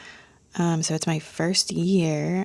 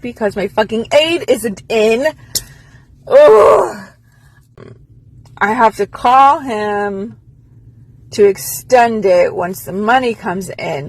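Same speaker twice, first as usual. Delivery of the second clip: angry venting; 2 semitones higher, 8 dB louder than usual. Real, not acted. Venting about financial aid.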